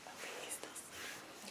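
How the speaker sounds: hushed whisper. Real, not acted. Checking on sleeping baby.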